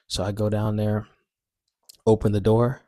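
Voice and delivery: ordinary delivery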